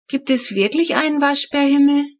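put-on a small girl asking about heaven; little girl's question. A narrator's reading. Small sister asks in bed.

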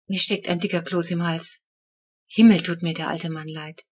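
usual speaking voice

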